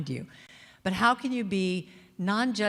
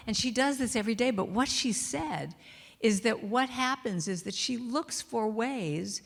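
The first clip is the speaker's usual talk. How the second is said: ordinary talk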